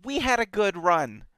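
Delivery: hammed-up weary faction member; resigned shrug. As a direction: throw it away like a tired quitter. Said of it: "A faction of them is like".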